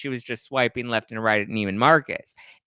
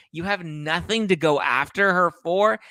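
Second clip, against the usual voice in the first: higher, rising, mocking disbelief; incredulous; 6 semitones higher than usual. Real, not acted. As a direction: throw his words back at him. Mocking repeat of his quote.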